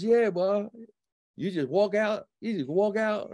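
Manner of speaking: high-pitched, insistent imitation; mock a stranger's disbelief; big guy. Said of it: "some big guy".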